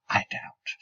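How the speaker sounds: whispered aside. Acted. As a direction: whisper it under the breath. Muttered aside in the poem.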